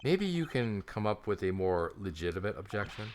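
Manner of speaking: ordinary delivery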